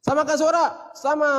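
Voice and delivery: calling very high and loud, following the hand. Shown as a demonstration. Teacher showing pitch scale with hand, very high call. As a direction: climb to the top of the scale. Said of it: "Ikuti nada tangga nada tangan saya".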